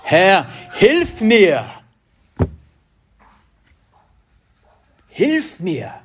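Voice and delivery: high, slow, pleading cry for help; desperate cry. Retold or imitated. The Canaanite mother cries out to Jesus, desperate and pleading. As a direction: cry out to the Lord, begging. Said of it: "schreit sie eindringlich".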